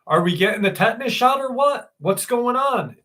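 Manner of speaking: high-pitched, quick dad imitation; impatient dad impression. Confused dad asking doctor about the shot, impatient. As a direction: rattle it off high, demanding an answer.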